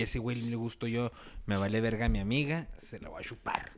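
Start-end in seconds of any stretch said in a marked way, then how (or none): 2.8-3.7 breathless whispered aside; hushed aside. Retold or imitated. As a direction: drop to a secret whisper. Voicing the girl's scheming thought. (susurro cómplice)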